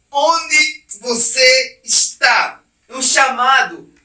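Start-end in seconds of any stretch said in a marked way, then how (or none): 0.1-2.6 slow, loud, drawn-out call; God calling out. Acted. God calls to hiding Adam, slow and loud. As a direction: call across the garden, stretching every word. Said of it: "Deus chega e pergunta".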